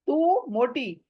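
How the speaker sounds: ordinary talk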